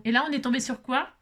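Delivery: ordinary delivery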